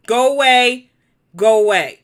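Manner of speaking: loud, firm dismissal, said twice; angry go away at the chatter. Real, not acted. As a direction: shoo them off loudly. Telling a blocked viewer off.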